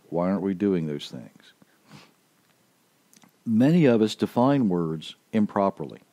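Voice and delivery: normal talking voice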